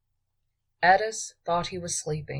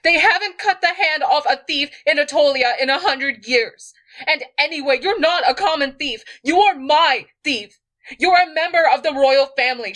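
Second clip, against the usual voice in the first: loud, much higher furious rant; angry tirade; 11 semitones higher, 8 dB louder than usual. A narrator's reading. Queen rants to her thief about the insult, furious. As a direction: rail at him without letup. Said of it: "snapped Edis".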